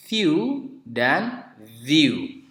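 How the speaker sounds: first "view" as few, second "view" with buzzing v; slow separated pair, few then view; contrasting f and v. Shown as a demonstration. Teacher contrasting two words, very slow few and view. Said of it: "Anda bisa membedakan nanti antara kata".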